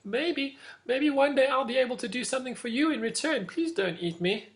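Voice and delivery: high, pleading mouse voice; scared little mouse. Acted. Frightened mouse begging the lion for mercy, high and pleading. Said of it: "got very, very scared and said".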